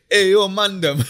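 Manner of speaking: hammed-up London roadman greeting; South London accent. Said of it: "South, south is".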